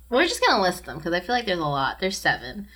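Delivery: normal talking voice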